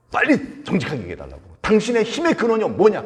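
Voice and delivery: high, insistent nagging demand; acted pestering. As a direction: nag him for the secret. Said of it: "막 때려 쓰는 거예요".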